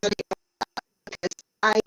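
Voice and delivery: breaking up like a bad call line; choppy robotic audio. Real, not acted. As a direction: voice cutting in and out. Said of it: "You're really choppy and robotic".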